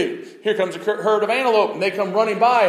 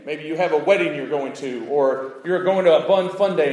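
normal talking voice

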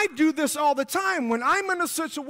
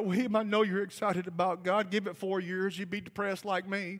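mock gloomy; 5 semitones lower, 7 dB quieter than usual. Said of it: "religious people put their arm around me and say".

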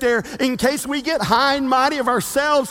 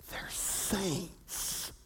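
hushed whisper; 6 semitones lower, 19 dB quieter than usual. Real, not acted. Repeated, first whispered.

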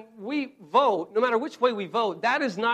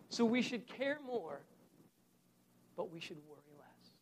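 from plain to much softer, nearly gone; fading hush; 3 semitones lower, 12 dB quieter than usual. Shown as a demonstration. Preacher closing a point, fading to soft. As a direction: let worry less die away.

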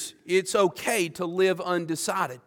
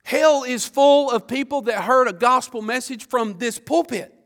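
higher, louder preaching about hell; fiery preaching; 5 semitones higher, 6 dB louder than usual. Acted. Preacher warning the congregation about hell, fiery and loud. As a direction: drive the warning home from the pulpit.

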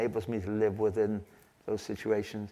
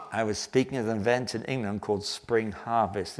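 ordinary talk, nothing marked

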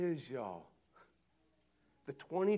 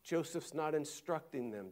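ordinary delivery

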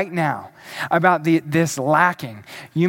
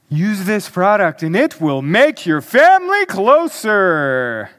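advertiser's voice. Acted. Pastor mocking advertisers' promises about family, advertiser's voice. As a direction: ham it up like a commercial announcer. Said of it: "That's my advertiser's voice".

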